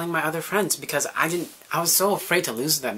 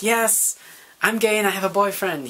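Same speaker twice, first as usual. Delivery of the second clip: tearful confession; 7 semitones higher, 4 dB louder than usual. Retold or imitated. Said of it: "I burst into tears".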